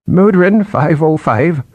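put-on slow, high trembling old man; quavering old voice. A narrator's reading. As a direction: quaver it out like a frail old scholar. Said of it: "a quavering voice replied".

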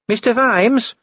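very high-pitched anxious officer; urgent call. A narrator's reading. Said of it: "beckoning urgently".

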